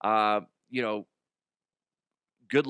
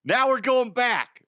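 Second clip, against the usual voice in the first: loud, high, drawn-out cheer; hyped-up opening; 11 semitones higher, 6 dB louder, slower than usual. Pretend. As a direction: belt it out like a hype man.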